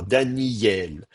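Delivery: "Daniel" as Daniy-yel, y held; lengthened yod. Shown as a demonstration. Teacher sums up the pronunciation, held yod in "Daniel". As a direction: stretch the glide before el in "Daniel". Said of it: "la prononciation qu'on va avoir".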